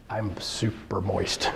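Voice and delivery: joking hushed aside; mock confession. Real, not acted. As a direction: confess it in a hush.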